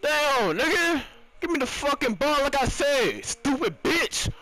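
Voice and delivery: high-pitched raging rant; angry gamer tirade, pitched high; furious yelling. Real, not acted. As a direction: scream abuse at the bad passer. Still raging at teammates' passes.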